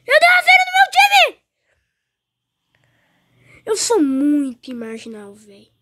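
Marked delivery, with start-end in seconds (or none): 0.1-1.4 much higher, louder furious shout; raging gamer. Real, not acted. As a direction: scream at the game in rage. Raging at his team.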